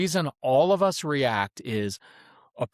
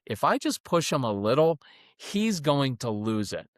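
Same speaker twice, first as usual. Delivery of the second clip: ordinary talk, nothing marked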